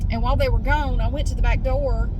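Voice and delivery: ordinary talk, nothing marked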